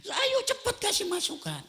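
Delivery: high-pitched impatient wife; hammed-up nagging wife; wife demanding feedback right away. Wife voiced pushing again.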